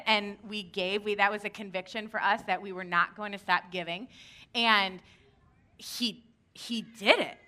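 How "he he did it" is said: normal talking voice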